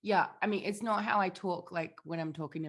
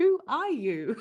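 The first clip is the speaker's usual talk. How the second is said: high, loud mock disbelief; mock bewilderment; 5 semitones higher, 9 dB louder than usual. Mum reacting to her son's foreign accent, mock bewilderment. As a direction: question your own child in playful astonishment.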